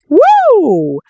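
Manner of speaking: very high, loud whoop; excited whoop. Real, not acted. Vlogger whooping as the judge is provoked, excited. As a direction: whoop at the drama.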